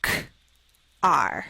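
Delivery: teacher sounding out car; sounded out slowly. Shown as a demonstration. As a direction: split car into K then R. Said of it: "break it up into the sounds that we hear".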